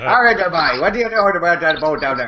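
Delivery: put-on Newfoundlander voice; Newfie accent. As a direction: imitate a Newfie talking to voice search. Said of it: "imagine a Newfie trying to use voice search".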